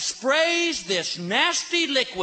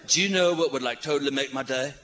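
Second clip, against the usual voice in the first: surfer dude; 7 semitones lower than usual. Retold or imitated. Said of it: "this guy's, like, right in my face".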